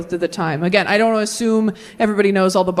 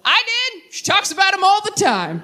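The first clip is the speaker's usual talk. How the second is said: dad's jab; 9 semitones higher than usual. Retold or imitated. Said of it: "my dad goes".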